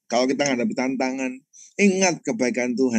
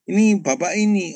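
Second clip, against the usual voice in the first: a higher, brighter caller voice; supplier's driver on the phone; 8 semitones higher, 3 dB louder than usual. Retold or imitated. Driver greets the pastor by phone, lightly higher voice. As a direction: lift the voice as the eager driver. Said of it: "itu supirnya supplier".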